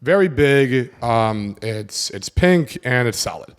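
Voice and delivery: put-on slow, hesitant girl's answer; mild slow mock answer; slow halting answer. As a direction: drag it out unsure. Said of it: "And she goes".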